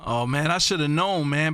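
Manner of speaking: ordinary delivery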